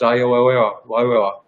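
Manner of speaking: imitating stuck, broken speech; a patient's words catching on "oh"; garbled speech. Imitating a woman whose words come out mixed up, as garbled speech. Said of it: "what would come out is".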